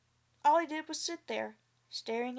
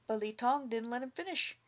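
plain reading voice

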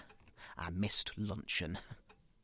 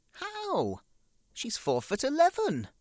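incredulous; 4 dB louder than usual. Real, not acted.

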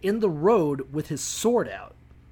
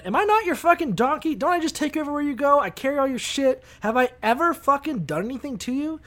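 high-pitched, aggrieved donkey appeal; hurt mock protest in a raised pitch; pleading donkey; 8 semitones higher than usual. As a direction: plead your case as a wronged donkey. Donkey pleads its case to Balaam.

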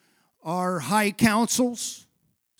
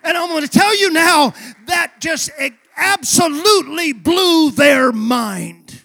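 louder, climbing preacher's shout; full-force preaching; 3 semitones higher, 6 dB louder than usual. Acted. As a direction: drive the vision home to the crowd. Builds to Stephen's vision.

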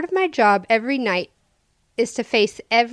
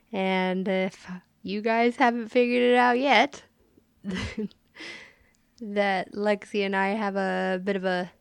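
ordinary talk

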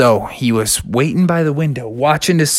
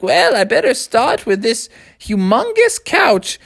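put-on old owl; 9 semitones higher than usual.

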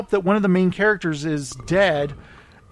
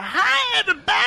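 much higher, mock-shouted catchphrase; sitcom catchphrase; 14 semitones higher, faster than usual. Host mimics actress greeting studio audience, high mock shout. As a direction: squeal out an imitation of a sitcom entrance. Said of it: "She'd be like".

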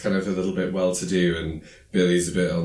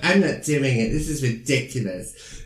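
put-on higher, huffy mean-girl refusal; snooty refusal; 6 semitones higher than usual. Chris refusing the coach with disdain. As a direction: toss it off like a spoiled queen bee. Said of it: "is like".

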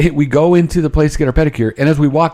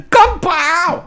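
high yelled Kung Pao across the salon; mock yell; 14 semitones higher than usual. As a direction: yell it as loud as the staff do. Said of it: "I yell it ... as loud as they do".

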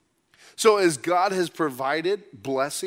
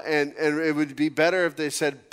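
ordinary talk

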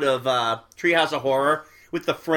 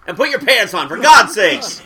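louder joking rebuke; mock scolding; 6 semitones higher, 8 dB louder than usual. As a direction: playfully tell the dad off, raised voice. Joking add-on to dad story.

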